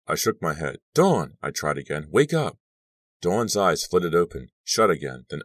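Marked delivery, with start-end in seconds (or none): 0.9-1.4 high, loud call; urgent calling. A narrator's reading. Trying to rouse unconscious friend. 2.1-2.6 high, loud urging; urgent calling. A narrator's reading. Trying to rouse unconscious friend.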